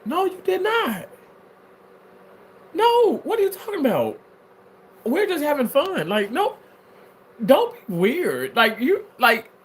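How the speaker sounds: high scoffing denial; indignant denial. Real, not acted. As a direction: push back, pitched far up. I got to you.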